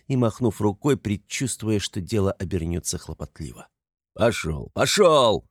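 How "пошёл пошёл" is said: gruff order rising to a shout; shooing the crowd. A narrator's reading. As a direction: drive onlookers away, louder the second time. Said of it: "разгонял он теснившихся кругом людей". (разгоняет толпу, повышая голос)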